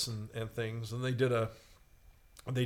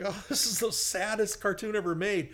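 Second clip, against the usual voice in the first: higher-pitched lament, half laughing; retold dismay; 8 semitones higher than usual. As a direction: bemoan it in mock heartbreak. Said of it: "I'm going like".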